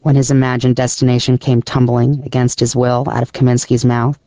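ordinary delivery, nothing marked

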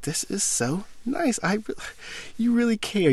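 imitating the fiancée gushing over dinner; touched fiancée. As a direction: coo it like she's touched.

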